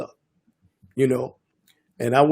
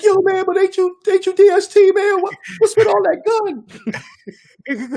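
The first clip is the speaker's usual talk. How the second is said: put-on much higher, louder starstruck kid voice; starstruck young kid; 3 dB louder than usual. Armed youths recognizing the producer, high starstruck voice. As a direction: squeak it up like a kid meeting his idol. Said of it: "They were like".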